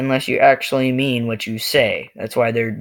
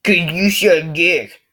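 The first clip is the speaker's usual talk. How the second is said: high, loud, drawn-out jeer; mock frustrated bully; 7 semitones higher, 6 dB louder, much slower than usual. Bully answers back in a sketch, mock frustration. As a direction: jab it out high, loud and slow. Acted bully getting louder.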